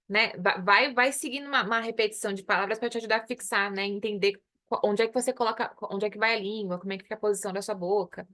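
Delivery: ordinary delivery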